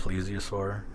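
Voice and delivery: softly trying plesiosaur as PLEE-zee-o-saur. Shown as a demonstration. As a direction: test out plesiosaur with a long ee, quietly. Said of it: "if I'm saying that correctly".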